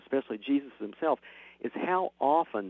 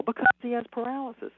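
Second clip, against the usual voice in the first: retold class shout, higher in pitch; kids yelling an answer; 11 semitones higher than usual. Teacher retelling his class all shouting an answer, mild mock yell. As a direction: call out the answer like a room full of kids. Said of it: "And they'll all yell".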